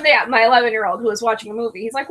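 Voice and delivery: normal talking voice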